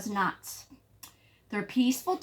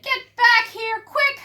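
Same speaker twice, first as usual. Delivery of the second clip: high, loud orders; rally the crowd fast; urgent command; 7 dB louder than usual. Acted. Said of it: "little Pipsqueak said".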